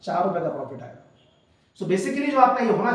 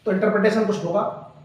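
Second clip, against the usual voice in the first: ordinary talk, nothing marked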